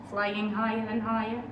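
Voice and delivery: plain reading voice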